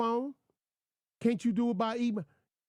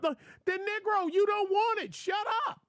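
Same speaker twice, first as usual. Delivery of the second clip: exasperated; 11 semitones higher than usual. Retold or imitated. Exasperated retort to complainer.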